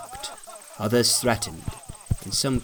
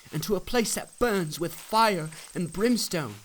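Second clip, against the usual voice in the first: higher, pleading pilgrim voice; 7 semitones higher, 4 dB quieter than usual. A narrator's reading. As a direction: urge the neighbours to come along.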